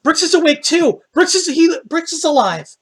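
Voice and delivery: high, loud, stammering joyful cry; excited shouting. Acted. Acted character announces friend lives.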